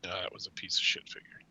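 hushed aside, voice dropped right down; a whispered throwaway aside. Real, not acted. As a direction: whisper it like a confession. Aside about bad figure.

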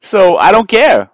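loud, high, drawn-out declaration; defiant outburst. Real, not acted. Dad brushing off the money he spent, loud and defiant. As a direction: belt it out slowly, way up in pitch.

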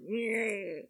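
high, soft imitation of heartbreak; Ralph. Cartoon boy as his heart breaks, high mock whine. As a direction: mimic a cartoon boy's heartbroken noise. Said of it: "where he's like".

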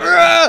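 high loud strained cry; strained yell. Retold or imitated. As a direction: let out a sudden strained yell.

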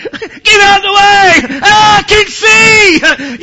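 high, slow, tearful joyful shout; ecstatic crying shout. Retold or imitated. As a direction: cry out in overwhelming joy. Healed blind man bursting out.

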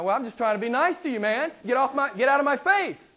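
lightly acted indignation; flustered young man. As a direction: play the startled boy backing off. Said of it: "MacArthur was like this".

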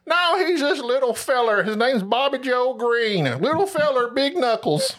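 high-pitched backwoods local telling his tale; hammed-up country eyewitness. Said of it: "in our neck of the woods is always the guy in the news".

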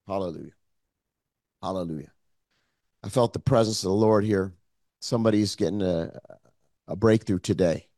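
very low, soft murmur; hushed reverence. Real, not acted. Preacher sensing the Lord's presence in the room, hushed and reverent. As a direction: drop right down to a reverent hush.

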